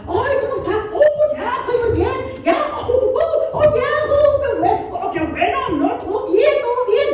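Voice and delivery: high-pitched, giddy, exaggerated gushing; overly happy. Preacher mimicking people trying to look too happy, overly happy. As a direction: gush with laughing, squealing over-the-top cheer. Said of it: "overly happy".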